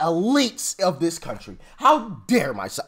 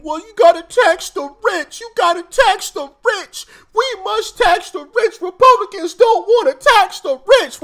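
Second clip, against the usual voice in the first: high, loud whiny mock chant of Democrats; mocking yell; 11 semitones higher, 7 dB louder than usual. Mocking Democrats yelling to tax the rich, high and loud. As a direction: ham up a shrill protester demanding taxes. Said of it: "they wanna yell and scream".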